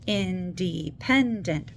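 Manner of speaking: "Independent" slowly split into four syllables. Shown as a demonstration. Teacher showing parents how to count syllables, "Independent" slowly split. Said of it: "How many syllables are in".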